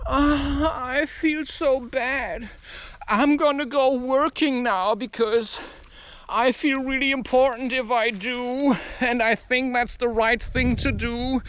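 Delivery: slightly high, whiny imitation; mock guilty whine. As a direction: imitate someone moaning about feeling bad. Mimics guilty people going to work.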